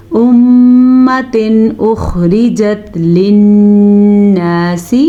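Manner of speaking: slow melodic tajweed chanting; Quran recitation. Acted. Teacher reciting the verse slowly for students, melodic chant. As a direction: draw each verse out in measured recitation.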